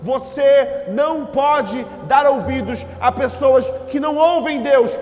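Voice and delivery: loud, high sermon building to the last word; emphatic preaching. Real, not acted. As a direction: drive it home to the congregation, climbing to a peak. Preaches at full force. (pregação enfática, crescendo até o grito)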